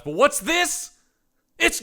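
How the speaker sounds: sudden high, loud reveal; spring the twist like a storyteller; mock dramatic surprise.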